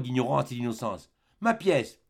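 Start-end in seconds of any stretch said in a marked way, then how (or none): 1.4-2.0 put-on high, timid little boy; a small Savoyard boy asking politely; small boy's voice. Child asks for coin.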